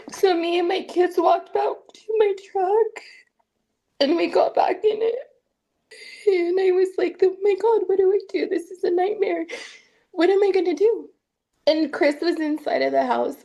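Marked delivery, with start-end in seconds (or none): 0.1-11.1 mother near tears, panicked about what to do; tearful. Real, not acted. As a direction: voice lifted higher and strained with tears. Tearful retelling after the bat threat.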